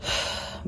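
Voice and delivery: soft, breathy exhale; weary sigh. A narrator's reading. Narrator mentally exhausted by the argument, weary sigh. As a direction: let out a tired sigh. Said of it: "I let out a sigh".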